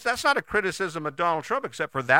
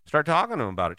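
usual speaking voice

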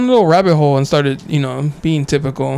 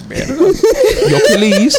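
mock dismay; 11 semitones higher, slower than usual. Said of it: "I was like".

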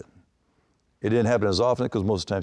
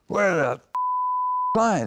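yelling for the pitcher across the clubhouse; angry yell; 12 semitones higher, much slower than usual. Retold or imitated. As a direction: storm in yelling for him. Storming in after the finger.

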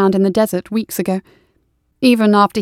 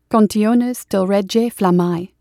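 slow title reading; slower than usual. Acted. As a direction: slowly read the Latin title.

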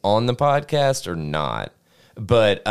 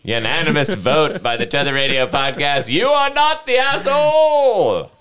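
town crier; 5 semitones higher, 3 dB louder than usual. Acted. Mock verdict announced to listeners.

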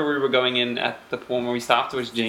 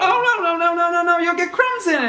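frantic rabbit protest; 14 semitones higher, faster than usual. Acted. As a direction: beg the Hatter to stop.

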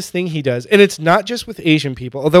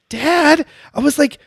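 dumbfounded plea; 8 semitones higher than usual. Retold or imitated. Dumbfounded child pleads with dad.

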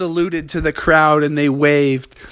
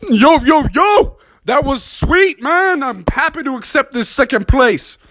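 rapper chimp; 9 semitones higher than usual. Acted. Rap Master Chimpanzee accepts second place, high hype. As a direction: bounce it like a cocky rapper.

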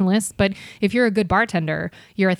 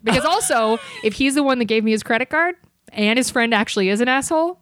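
ordinary delivery

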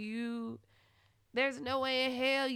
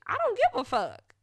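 normal talking voice

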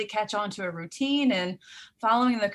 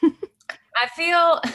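high, louder, awkward hesitation; sheepish stalling; 10 semitones higher, 6 dB louder than usual. Real, not acted. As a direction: hedge in a high voice. Sheepish stalling when asked her feelings.

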